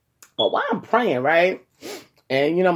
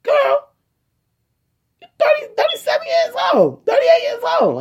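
high, loud scolding mom; mom's outburst; 3 dB louder than usual. Retold or imitated. Mother on the phone yelling at her pregnant daughter, shrill and loud. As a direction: shriek it like a shocked mother. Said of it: "She was like".

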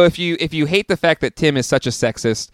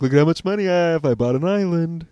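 bragging rich voice; 3 semitones higher than usual. Retold or imitated.

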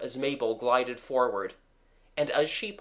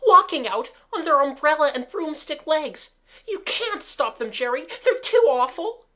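high, loud panicked voice; frightened crying out; 13 semitones higher, 8 dB louder than usual. A narrator's reading. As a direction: keep crying out in horror. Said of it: "screamed Kathleen".

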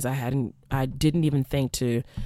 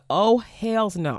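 slow, drawn-out higher hells no; sassy stretched-out no way; defiant refusal; 6 semitones higher, much slower than usual. Retold or imitated. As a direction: plant your feet and refuse. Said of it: "And I said".

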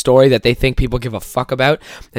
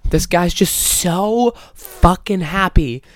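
slow emphatic; 5 semitones higher, much slower than usual. Real, not acted. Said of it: "emphatic exasperation".

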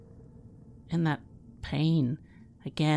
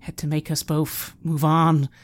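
louder, slow bitter words about stupid people; bitter outburst; 2 semitones higher, 7 dB louder, slower than usual. Acted. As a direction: let the anger rise, drag each word. Nigel's bitter outburst at injustice.

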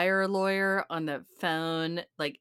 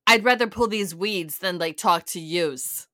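lightly voiced Jersey mom, louder; mock gruff mom; 6 dB louder than usual. Mom in the backyard brushing off her daughter, fed up. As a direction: imitate a fed-up mom, punching it. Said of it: "mom is, like, in the backyard".

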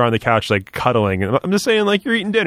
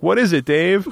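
infomercial sidekick; 7 semitones higher, slower than usual. Pretend. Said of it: "And Swoozy's like".

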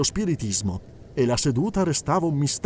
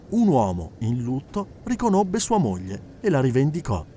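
normal talking voice

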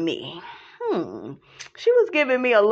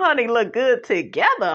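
ordinary talk

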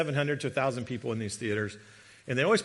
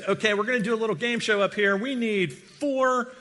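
higher-pitched game show host call; cruise director announcing; 7 semitones higher, 4 dB louder than usual. Retold or imitated.

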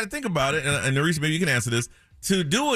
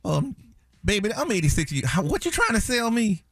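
put-on old Southern woman; 7 semitones higher than usual. Mom voices an old Southern woman to tease a phone solicitor. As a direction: play a little old lady on the phone.

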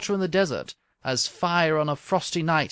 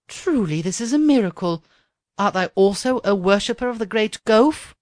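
higher and louder with wonder; excited princess; 6 semitones higher, 6 dB louder than usual. Acted. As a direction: gasp it out as if seeing a miracle. Princess amazed at a fellow believer.

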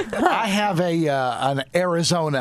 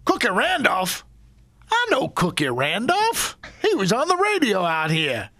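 higher-pitched Arizona lawman in recognition; Arizona cop accent; 5 semitones higher than usual. Retold or imitated. Cop recognizing driver's radio buddy, Arizona accent. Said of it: "this Arizona accent".

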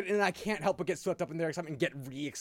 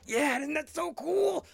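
very high, drawn-out squeal of enthusiasm; over-the-top fanboy excitement; hyped up, re-enacted; 14 semitones higher, 5 dB louder, much slower than usual. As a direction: gush loudly as if matching an excited kid. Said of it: "getting hyped with him".

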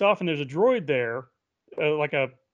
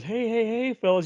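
higher, singled-out character voice; smooth-talking interloper; 7 semitones higher than usual. Retold or imitated. Lavender-skinned stranger stepping into a quarrel, voice pitched up. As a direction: lift the pitch as you break in. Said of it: "you can almost hear his voice".

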